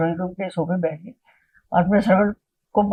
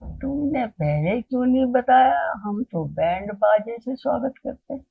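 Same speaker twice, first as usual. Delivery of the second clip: slow, drawn-out, slightly raised voice; Baba Ji; 4 semitones higher, slower than usual. Retold or imitated. Guru welcoming unannounced visitors, in a slow drawl. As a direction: imitate a holy man's leisurely welcome. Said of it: "तो बस बाबा जी कहा".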